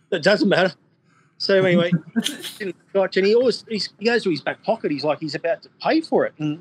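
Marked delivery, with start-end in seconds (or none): 0.1-3.6 put-on Con's accent. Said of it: "the, the Con accent".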